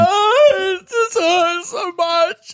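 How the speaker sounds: high, slow fake wail; hammed-up anguished whine; mock hurt. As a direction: whimper theatrically as if wounded. Mock pain at accent.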